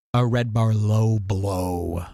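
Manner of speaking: deep, slow announcer shout; big booming segment title voice. Shown as a demonstration. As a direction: boom it out like a show title card. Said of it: "Now, this is called".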